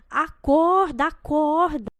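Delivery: high, loud desperate shout. A narrator's reading. Bride shouting at her collapsed groom, loud and high. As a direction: yell at him to wake up. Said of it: "Dona Josefa grita".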